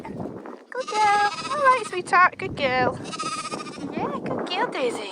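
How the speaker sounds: high-pitched reassuring dog talk; cooing to the dog. Real, not acted. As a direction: soothe the dog up high like talking to a pet. Reassuring the dog while riding.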